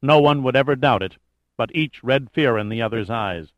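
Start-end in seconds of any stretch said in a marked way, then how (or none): none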